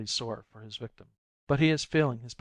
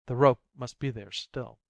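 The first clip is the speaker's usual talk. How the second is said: usual speaking voice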